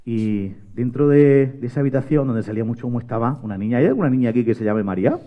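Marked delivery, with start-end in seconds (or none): none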